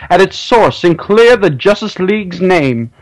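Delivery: high-pitched mock announcer; sustained high announcer delivery; announcer's voice. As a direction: read it as a breathless episode announcer. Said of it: "announcer's voice".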